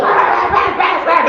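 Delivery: high, rapid barks; frantic high-pitched guard dog barks; imitated dog barking. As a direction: yap like a dog behind a door. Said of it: "all you hear is them on the other side".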